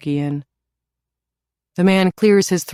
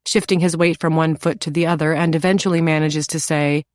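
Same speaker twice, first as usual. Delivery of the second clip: plain reading voice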